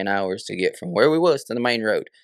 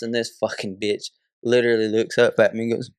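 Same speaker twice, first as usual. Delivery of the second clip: ordinary delivery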